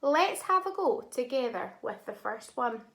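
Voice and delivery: ordinary talk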